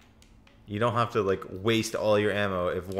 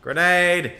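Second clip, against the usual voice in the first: loud high urgent call; shouted warning; 7 semitones higher, 8 dB louder than usual. Real, not acted. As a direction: yell the warning to your teammate. Warning teammate of a grenade.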